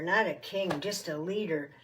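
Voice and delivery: ordinary delivery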